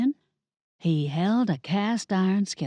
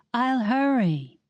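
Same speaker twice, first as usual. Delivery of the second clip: slow rabbit voice; 5 semitones higher, slower than usual. Acted.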